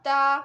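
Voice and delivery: ता called out loud and clear; loud letter sound. Shown as a demonstration. Teacher to children, sounding out ते: loud ता. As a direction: hit ता loudly for the kids. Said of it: "ते से ताला".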